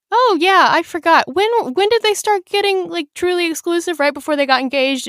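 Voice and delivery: high, bright mock-innocent voice; mock casual. Friend voicing Olive's sly question to Ethan, high and mock-casual. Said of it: "pulls a power move on him and is, like, very casual".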